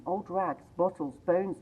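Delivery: plain reading voice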